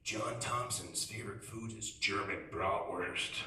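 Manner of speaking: slow, low, ominous ad voiceover; ominous attack ad narrator. Retold or imitated. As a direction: read it like a sinister campaign ad. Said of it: "It's like".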